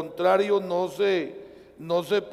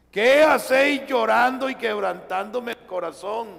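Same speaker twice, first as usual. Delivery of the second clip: preached quote; 5 semitones higher, 6 dB louder than usual. Retold or imitated.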